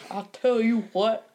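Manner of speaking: slow Texan drawl; Hank Hill voice. Retold or imitated. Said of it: "Hank Hill".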